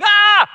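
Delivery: loud, high yelp of fright; sudden high-pitched panicked shriek; terrified scream. Acted. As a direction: scream out in mock terror. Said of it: "flip from fear to faith".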